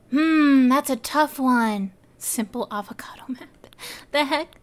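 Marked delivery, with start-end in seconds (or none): 2.2-3.5 whispered. Real, not acted. Reading item names.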